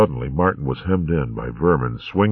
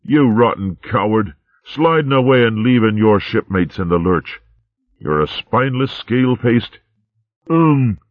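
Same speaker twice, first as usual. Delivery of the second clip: angry sea rat; 6 semitones higher than usual. A narrator's reading. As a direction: berate the cowardly general. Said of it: "an angry sea rat".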